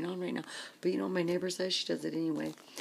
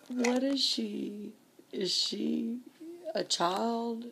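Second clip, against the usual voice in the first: exasperated disbelief; 6 semitones higher, slower than usual. Real, not acted. Exasperated rhetorical outburst.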